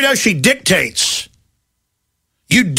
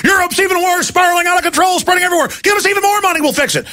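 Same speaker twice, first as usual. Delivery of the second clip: mock banker shrieking for more money; whiny panicked banker; 9 semitones higher, faster than usual. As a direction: ham up a desperate banker begging for cash. Mocking bankers begging for bailouts.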